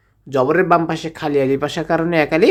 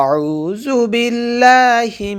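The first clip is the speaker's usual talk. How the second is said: slow, melodic tajweed chant, higher; Quran recitation; 3 semitones higher, much slower than usual. Acted. Teacher opening the class with slow Quran recitation. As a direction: recite with long drawn vowels.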